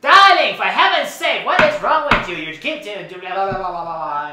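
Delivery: very loud, high-pitched diva outburst; theatrical scolding. Acted. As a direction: scold them like an exasperated designer, tumbling into blahs. Mock scolding of silent co-host.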